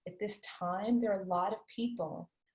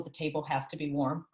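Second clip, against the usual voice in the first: ordinary talk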